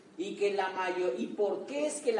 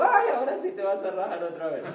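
teasing sarcasm; 6 dB louder than usual. Retold or imitated. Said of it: "Y yo".

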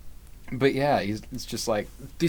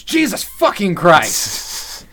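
slow, drawn-out furious curse, higher and louder; angry yell; 6 semitones higher, 7 dB louder, much slower than usual. Retold or imitated. Elliot cursing at the cross, slow angry shout. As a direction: spit each word out in a rage. Pretty fucking mad, yells.

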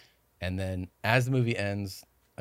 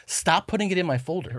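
higher-pitched mock exasperated plea; 7 semitones higher than usual. Host scolding his crew for tempting him, exasperated. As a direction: plead with them in mock exasperation.

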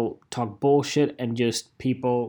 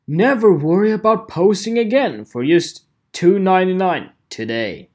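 louder, higher caps-lock ad voice; reading caps loud; 5 semitones higher, 7 dB louder than usual. Retold or imitated. Reading a shouty all-caps ad to viewers, loud and high. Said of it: "Guess if it's in caps or not".

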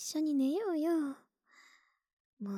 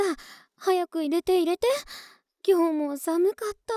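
higher and louder, bouncing with impatience; eager pleading; 5 semitones higher, 7 dB louder than usual. Acted. Girlfriend at the door begging her partner to let her in quickly. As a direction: beg to be let in out of the cold.

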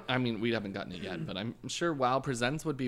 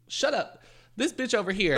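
high, loud teasing shout; playful yell; 9 semitones higher, 5 dB louder than usual. Retold or imitated. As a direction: tease mom from the passenger seat. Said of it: "I'm like".

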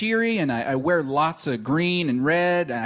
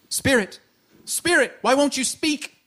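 pleading; 8 semitones higher than usual. Acted. Pleading to silent ghost.

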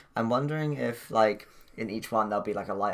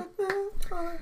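high, eerie sing-song title; spooky echo; slower than usual. Pretend. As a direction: echo it like a ghost.

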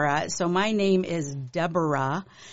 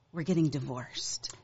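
quiet somber announcement; 5 semitones lower, 8 dB quieter than usual. Retold or imitated. Parents announce divorce quietly.

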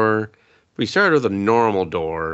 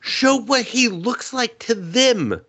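mock high plea; 13 semitones higher, slower than usual. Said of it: "I was like".

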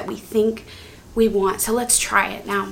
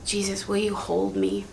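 soft plea; 2 semitones lower, 9 dB quieter than usual. Shown as a demonstration. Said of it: "Sometimes words".

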